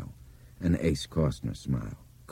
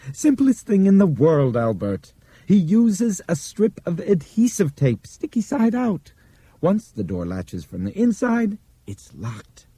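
high, pleased, knowing voice; smug older man; 13 semitones higher, 4 dB louder than usual. A narrator's reading. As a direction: lecture it like a smug know-it-all. Jenkins explains, pleased with himself.

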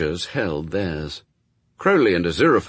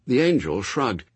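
ordinary delivery

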